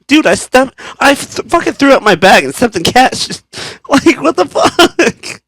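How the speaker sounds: high, loud mock-desperate protest; hammed-up retold exasperation; exasperated rant. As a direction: rant in squeaky disbelief, drawing out the end. Retold outburst at child.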